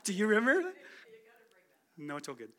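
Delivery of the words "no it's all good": ordinary delivery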